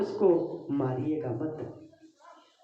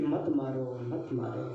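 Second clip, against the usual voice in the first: softly pleading, fading lower and quieter; 6 semitones lower, 6 dB quieter than usual. Acted. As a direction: plead gently, voice sinking, a touch of sorrow. Draupadi pleads to spare him.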